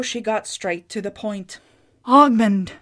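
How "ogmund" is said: loud, higher call of his name; cried out. A narrator's reading. Wife finding her weeping husband, crying out. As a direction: call out to him in shock. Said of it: "she cried".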